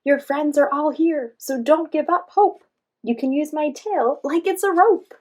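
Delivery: cheering up a friend far off; loud encouraging call. A narrator's reading. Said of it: "Mike called out to Torty".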